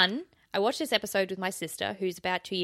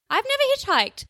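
surprised squeak; 6 dB louder than usual. Real, not acted. Sudden high outburst in banter.

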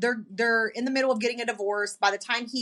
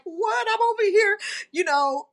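retold crying; 9 semitones higher than usual. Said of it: "I'm over here crying like".

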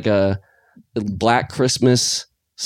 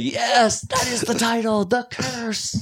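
mock triumph; 11 semitones higher, 4 dB quieter than usual. Mock cheering at the title reveal.

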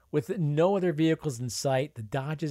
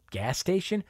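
rising disbelief on station; incredulous; 7 semitones higher than usual. Acted. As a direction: scoff in disbelief at a gas station. Scoffing at peanut stand.

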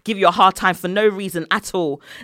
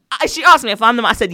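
ordinary talk

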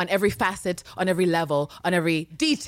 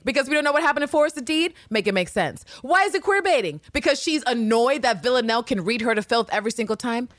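ordinary talk, nothing marked

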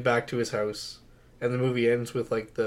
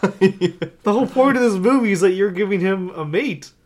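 high, loud protest; real exasperated disbelief; 8 semitones higher, 8 dB louder than usual. Real, not acted. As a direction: protest loudly at the absurdity. Exasperated at film's absurd twist.

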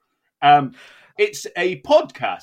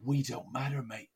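mock Brian Cox musing; 2 semitones lower, 6 dB quieter than usual.

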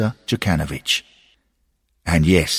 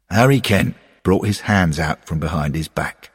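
ordinary delivery

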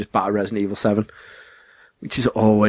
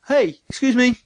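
high, polite calling out; mock polite call; 12 semitones higher than usual. [name] hailing a stranger, high polite voice. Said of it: "You don't say".

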